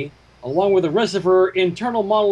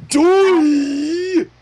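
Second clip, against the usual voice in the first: loud high cry of a name; calling out; 10 semitones higher, 6 dB louder than usual. Acted. Player calls out in a high loud cry.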